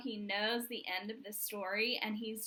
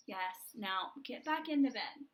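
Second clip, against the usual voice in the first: ordinary delivery, nothing marked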